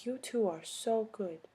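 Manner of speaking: a hint of a sweet old European lady, slow and soft; lightly voiced old lady. Old lady to two young pianists, lightly imitated, slow and soft. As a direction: softly imitate an old lady's slow compliment. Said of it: "This little old lady comes up".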